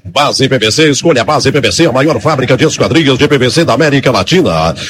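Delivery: low, smooth commercial voice; radio ad read. Acted. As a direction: read it like a sponsor spot. Ad reads between plays.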